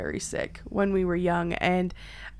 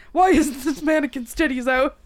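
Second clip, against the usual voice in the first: dad impression; 5 semitones higher, 5 dB louder than usual.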